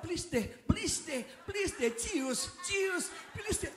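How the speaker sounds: high, slow pleading please deh; money begging. Pretend. Money begs the person who plays hard to get, whining. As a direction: beg like a whiny suitor. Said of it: "Nanti uangnya bilang".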